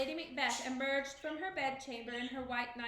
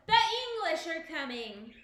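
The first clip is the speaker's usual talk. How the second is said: excited shout; 4 semitones higher, 8 dB louder than usual. A narrator's reading. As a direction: yell the alarm from the hilltop. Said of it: "shouted the lookout excitedly".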